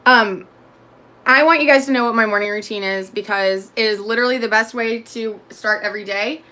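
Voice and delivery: ordinary talk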